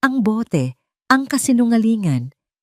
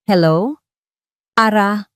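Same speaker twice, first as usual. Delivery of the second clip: louder phone greeting; 3 semitones higher, 5 dB louder, slower than usual. A narrator's reading. Calls his wife on phone.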